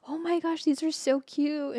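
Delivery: high, slow gushing; a doting mom cooing. Retold or imitated. Mom cooing over little animal figurines. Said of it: "my mom's like".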